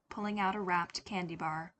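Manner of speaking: ordinary delivery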